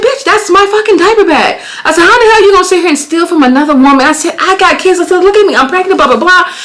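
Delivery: high-pitched tirade; going off. Retold or imitated. Mother ranting at the thief in the street, high furious tirade. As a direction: rant at her, voice way up. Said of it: "I'm just going off".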